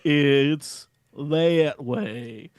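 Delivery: put-on very slow, drowsy Boston accent drawl; half asleep, dragging every word out; sleepy tavern owner. Tavern owner directs lost adventurers across the street, drowsy and slow. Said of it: "He's like".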